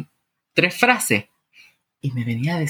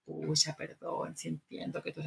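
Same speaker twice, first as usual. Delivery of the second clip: sheepish apology; 17 dB quieter than usual. Retold or imitated. Husband apologizing to his indignant wife, hushed sheepish voice. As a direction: apologize meekly, almost inaudibly.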